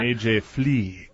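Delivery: slow, bad French accent. Shown as a demonstration. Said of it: "Say it in my worst French accent ever".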